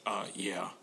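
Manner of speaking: mock gravelly tough-guy mumble. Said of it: "weird kind of gravelly voice".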